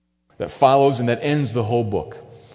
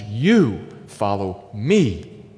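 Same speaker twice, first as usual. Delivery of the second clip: "you" slow, low and heavy; slow, low "you follow me" with weight on "you"; stress on you; 4 semitones lower, much slower than usual. Acted. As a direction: lean hard on "you", drawn out. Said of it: "emphasis in the original language".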